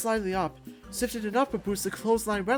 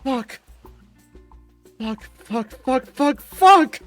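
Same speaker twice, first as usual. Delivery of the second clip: cursing that builds to a high shout; panicked cursing; 5 semitones higher, 12 dB louder, much slower than usual. Acted. Fighter stuck in a painful hold, cursing louder and louder. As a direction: start tight, end yelling in pain.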